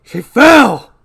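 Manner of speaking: loud, high burst of protest; shouted denial. Acted. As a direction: cut him off in outrage. Acted denial of killing wife.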